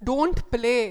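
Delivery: Don't hit loud and firm; teacher forbidding, weight on Don't; stress on don't. Shown as a demonstration. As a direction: bark the Don't like a strict teacher. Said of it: "don't కి stress వేస్తున్నాం".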